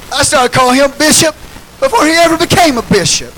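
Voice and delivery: ordinary delivery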